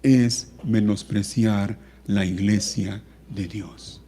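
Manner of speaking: measured and subdued pulpit voice; solemn preaching. Real, not acted. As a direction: deliver a grave, hushed warning with long pauses. Preacher gravely admonishes the congregation.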